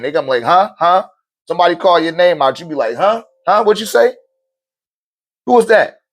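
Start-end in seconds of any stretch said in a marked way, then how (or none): 0.4-1.1 higher, questioning huh; responding to name. Acted. Someone reacting to their name called. 3.0-6.0 high-pitched, puzzled reply; who's calling me, pitched way up; startled response. Acted. Someone reacting to their name called.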